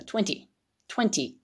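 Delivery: twenty cut off short and crisp at the end; twenty said short, chopped off; short clipped twenty. Shown as a demonstration. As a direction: clip twenty off quickly, no long ending. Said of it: "これは短くね、切るんですよ".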